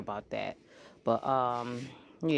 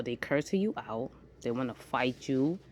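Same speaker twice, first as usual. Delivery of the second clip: normal talking voice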